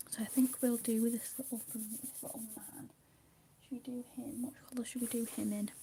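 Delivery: hushed ASMR whisper. Acted. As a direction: whisper gently, as if not to break the calm. Whispered colouring decisions.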